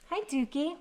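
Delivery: high sing-song pet voice; talking sweetly to the dog. Real, not acted. Greeting the dog.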